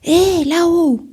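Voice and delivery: loud, high, drawn-out call upward. Acted. Alfred calling up to the birds high above, loud and high. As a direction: call out to someone far above.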